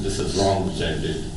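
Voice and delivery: ashamed murmur. Retold or imitated. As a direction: admit it quietly, ashamed. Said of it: "you feel embarrassed".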